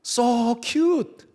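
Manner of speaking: high, loud, hammed-up English gush; gushing exclamation. Preacher jokingly translating the phrase for interpreters, gushing. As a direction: squeal it as a comic mock translation.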